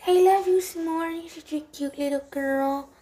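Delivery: high, loud cooing at the cat; sing-song love talk to a pet. Real, not acted. Cooing over her cat.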